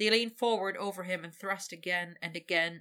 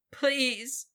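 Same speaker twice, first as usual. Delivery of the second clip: much higher, louder pleading cry; desperate cry; 7 semitones higher, 6 dB louder than usual. Acted. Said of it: "he cried desperately".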